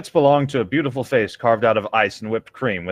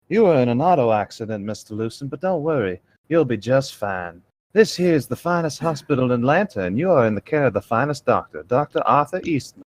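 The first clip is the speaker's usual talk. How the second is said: put-on sexy Georgia peach accent; soft Southern accent. Nurse reassuring an accident patient, soft Southern accent. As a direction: speak with a soft, unhurried Southern lilt. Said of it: "should i give her a soft southern accent?".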